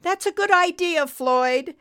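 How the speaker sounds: high, loud nurse voice; radio nurse. A narrator's reading. Nurse approves the trucker's plan, high radio voice. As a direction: pitch it up, calling over a crackly radio. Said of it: "Betty's voice cracked over the radio".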